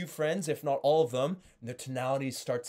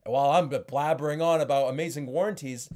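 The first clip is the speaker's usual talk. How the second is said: normal talking voice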